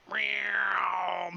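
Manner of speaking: loud, high playful meow; cat meow. Pretend. Mock cat meow at the wedding.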